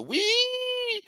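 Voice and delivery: long, very high-pitched "wee"; imitated wave-riding whee. Streamer showing viewers the missed wave ride, high whee. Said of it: "I couldn't show you the".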